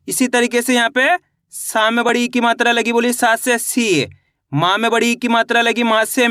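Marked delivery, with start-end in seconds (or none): 3.6-4.1 सी with a long, held ee; drawn-out vowel. Shown as a demonstration. Teacher demonstrating the big matra, सी stretched out. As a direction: stretch the ee of सी slowly. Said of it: "खींच के पढ़ा जाता है".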